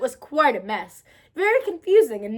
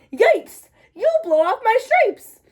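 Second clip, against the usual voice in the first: loud, high yelp of alarm; yelling zebra; 8 semitones higher, 5 dB louder, slower than usual. A narrator's reading. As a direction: yelp in panic. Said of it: "The zebra yelled".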